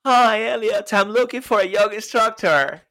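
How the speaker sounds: laughing through a hammed-up accent as [name]; put-on accent. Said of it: "What accent is that?".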